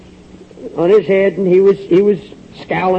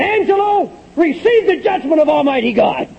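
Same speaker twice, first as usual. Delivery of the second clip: mock grand preacher pronouncing judgment, pitched higher; pompous man of God; 7 semitones higher than usual. Mocking his proud old self pronouncing judgment on Angelo, hammed-up and grand. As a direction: ham up a self-important preacher calling down judgment. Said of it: "as God's big man of the hour".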